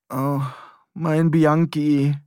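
slow, mourning over a broken bike; drawn out as if over a fallen friend; slow lament for a wrecked bicycle; grieving lament. Acted. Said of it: "in einem Tonfall, als läge ein Angehöriger oder Freund danieder".

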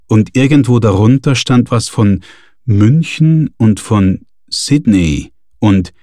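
ordinary delivery, nothing marked

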